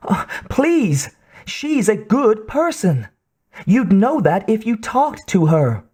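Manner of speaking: high pleading on the verge of crying; young man nearly crying; near tears. A narrator's reading. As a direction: beg as if about to cry. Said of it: "Emmerich sounded as if he might cry".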